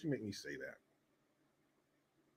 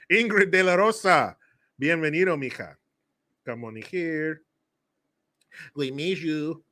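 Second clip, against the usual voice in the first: normal talking voice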